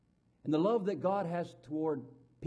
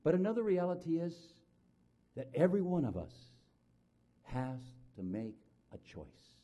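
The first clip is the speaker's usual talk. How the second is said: usual speaking voice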